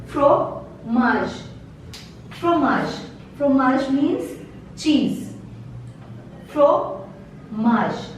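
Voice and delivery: fromage said fro-mazh, ending on zh; silent final E. Shown as a demonstration. Teacher drilling fromage for students, no final E. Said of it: "E is silent here".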